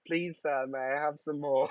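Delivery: high, pleading British urchin; Oliver Twist. Pretend. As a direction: beg like a Victorian orphan in a British accent. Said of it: "That was like Oliver Twist".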